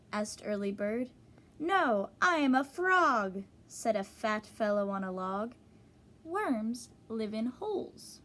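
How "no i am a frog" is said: a louder, bold frog answering. Acted. Frog on a log answers Early Bird, loud and bold. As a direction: boom it out like a proud frog on a log.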